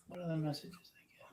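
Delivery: very soft, whispered aside; muttering to self. Real, not acted. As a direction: mumble it while checking messages. Muttering while checking own messages.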